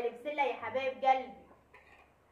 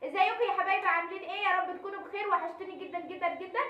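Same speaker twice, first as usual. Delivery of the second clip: energetic vlog intro; 7 semitones higher, 7 dB louder than usual. Acted. Vlog intro address to viewers.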